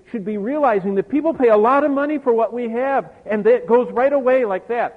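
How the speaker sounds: loud, high-pitched sermon delivery; impassioned preaching. Real, not acted. Preacher urging believers to value salvation's satisfaction, impassioned preaching. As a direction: drive the point home at full force. Preacher drives home the lesson.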